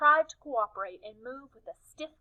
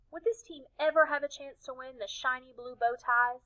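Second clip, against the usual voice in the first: usual speaking voice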